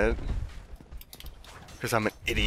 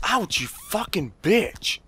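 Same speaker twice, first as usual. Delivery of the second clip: high, slow, drawn-out curse; pained cursing; 8 semitones higher, much slower than usual. Real, not acted. Player hit by an enemy, pained cursing. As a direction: draw the insult out after a painful hit.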